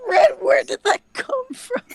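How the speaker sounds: high, laughing disbelief; astonished laughing. Real, not acted. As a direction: squeal it in disbelief.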